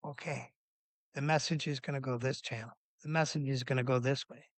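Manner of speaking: soft, focused self-talk; hushed concentration, as if thinking aloud; retold inner thought. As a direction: talk yourself through it quietly. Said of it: "all I kept thinking about is".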